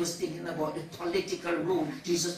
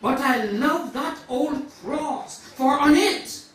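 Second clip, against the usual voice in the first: raised, higher sermon voice; fervent preaching; 10 semitones higher, 13 dB louder than usual. Acted. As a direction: preach it out with rising force. Preaches at full force.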